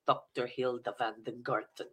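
put-on low German-accented character voice; German sex therapist. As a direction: announce the name in a comic German accent. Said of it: "I was a German sex therapist".

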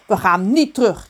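acted indignant retort from a mother. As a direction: push back firmly on NIET. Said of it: "riep zijn moeder verontwaardigd".

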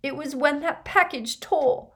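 put-on high, tearful girl's voice; sobbing. A narrator's reading. Leanne pointing out the package, sobbing. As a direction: tell it through sobs. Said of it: "sobbed Leanne".

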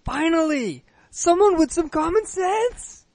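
high, slow, relieved exclamation; excited relief. Acted. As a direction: cry out with delighted relief. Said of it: "I need to get in character here".

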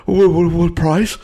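high, loud breathless stammer; excited stammer. Acted. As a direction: stammer it out high, all worked up.

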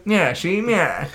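hammed-up forties reporter catchphrase; mock 1940s tough-talker tag; old-time reporter. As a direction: put on an old movie interrogator voice. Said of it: "that's a reporter voice more than cop voice".

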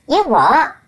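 loud, offended "you what"; indignant outburst. Acted. Brain voice snaps back at the carer, loud and offended. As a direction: bark it back, affronted.

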